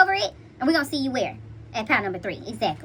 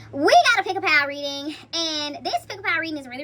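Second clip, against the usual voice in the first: normal talking voice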